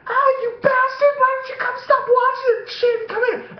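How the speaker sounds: loud, very high mock tirade; pissed girlfriend. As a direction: imitate her furious wailing in hammed-up falsetto. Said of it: "she was so pissed".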